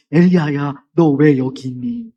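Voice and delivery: put-on a grieving father gently asking his child; gentlest voice. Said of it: "가장 부드러운 음성으로". (가장 부드럽고 안타까운 목소리)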